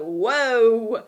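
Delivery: loud, awed exclamation; impressed whoa. Retold or imitated. Reacting to a brave first audition, loud whoa. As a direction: blurt it out, wowed.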